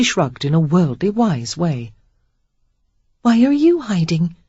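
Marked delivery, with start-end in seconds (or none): 3.2-4.4 put-on curious young woman. A narrator's reading. Sarah voiced in dialogue.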